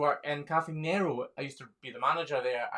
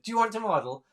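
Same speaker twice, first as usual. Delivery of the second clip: stranger's question; 8 semitones higher, 6 dB louder, faster than usual. Retold or imitated. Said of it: "this woman came up to me and said".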